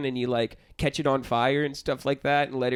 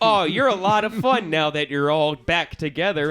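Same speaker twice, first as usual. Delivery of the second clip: raised, loud mock enthusiasm; mock fun friend; 4 semitones higher, 5 dB louder than usual. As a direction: gush in mock delight, higher and louder. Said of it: "it's just like".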